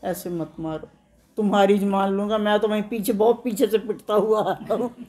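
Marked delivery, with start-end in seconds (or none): 0.1-0.9 very soft, low plea; meek pleading. Acted. Beaten simpleton begs the wedding party, meek pleading. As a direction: beg quietly and feebly not to be hit.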